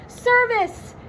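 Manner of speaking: high triumphant shout. Acted. Shaggy swings a racket at the balls, high shout. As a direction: call it like a tennis serve. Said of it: "shouted Shaggy".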